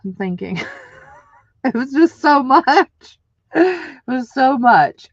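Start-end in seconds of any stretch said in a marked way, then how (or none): 1.6-5.1 louder, higher, slow voice breaking into tears; real crying. Real, not acted. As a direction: overcome while remembering. Breaks down recalling the birth.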